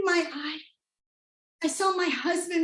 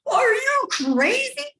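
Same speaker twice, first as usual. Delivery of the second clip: high, stretched disbelieving cry; husband's incredulous outburst; 10 semitones higher, 4 dB louder, slower than usual. Retold or imitated. Wife quoting her husband's reaction, high and incredulous. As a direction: throw his shocked question back at her. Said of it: "his exact words were".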